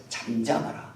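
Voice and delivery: low, soft mock-scriptural command; genteel, hushed solemnity; lofty, refined. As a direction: hush them with stately restraint. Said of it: "굉장히 고급지게 써놨어요".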